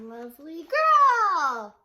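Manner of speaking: slow, loud, high proclamation; grand finish. Acted. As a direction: draw out the finale. Wizard completes chant.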